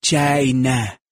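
slow, drawn-out impression saying "China"; exaggerated, elongated "China" impression; Trump. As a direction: stretch out "China" in a mock presidential voice. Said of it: "or as Trump would say".

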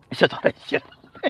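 whispered aside; hushed, amused repeat. Real, not acted. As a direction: breathe it like a secret. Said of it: "whispered repeat".

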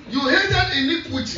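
slow, high preaching delivery; emphatic scripture echo. Real, not acted. Preacher repeats the reader's verse to congregation, slow emphatic preaching. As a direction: stretch each word out for the congregation.

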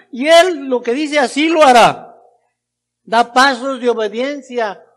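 high, loud declaration of faith; full-force preaching. Real, not acted. Preacher declaring belief in Jesus to the congregation, full-force preaching. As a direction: proclaim it to the whole congregation.

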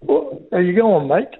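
put-on English accent straining at an Aussie greeting; a pom having a go at sounding Australian; English trying Aussie. [name] greets the hosts in a put-on English-accented Aussie way. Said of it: "that sounded like a pom trying to be a".

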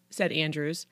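ordinary delivery

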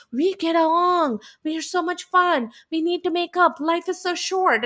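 imitating a two-faced sweet turnaround; sudden fake gush. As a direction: chirp it high like a fake make-up plea. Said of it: "she does the Ramona move where she flips".

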